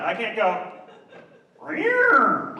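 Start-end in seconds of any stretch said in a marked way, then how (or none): 1.6-2.6 high cat yowl, falling at the end; imitated high-pitched meow; cat sound. Shown as a demonstration. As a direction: screech like an annoyed cat. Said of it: "I can't make this sound".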